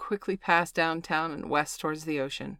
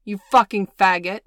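furious shout down the hallway; yelling; 4 semitones higher, 13 dB louder than usual. Acted. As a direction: scream the insult after him. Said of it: "She called one last time".

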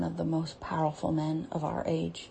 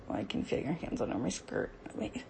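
hushed; 7 dB quieter than usual. Real, not acted. Softly while being touched.

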